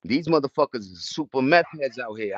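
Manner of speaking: ordinary delivery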